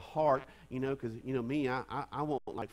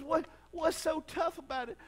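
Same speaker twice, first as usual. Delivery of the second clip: higher, gentle mother's voice; mama asking; 11 semitones higher, 3 dB louder than usual. Retold or imitated. Pastor voicing the mother asking her toddler, lightly high. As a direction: ask it like a patient mama. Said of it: "She says".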